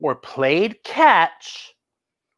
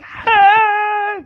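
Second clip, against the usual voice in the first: high playful yelp; a silly high "Ah!" like a dancing skeleton; 12 semitones higher, 3 dB louder than usual. Pretend. Storyteller voicing the skeleton's wild dance move with a yelp.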